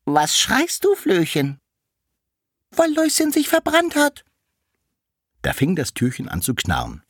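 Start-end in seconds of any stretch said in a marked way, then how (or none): 0.0-1.6 much higher, louder, squeaky little door; a tiny door asking in a squeak; the little door asks the flea, high and squeaky. Acted. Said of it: "Da sprach die kleine Stubentür". 2.7-4.2 very high, louder tiny flea voice; the flea answers the door in a shrill little squeak; wailing flea. Acted.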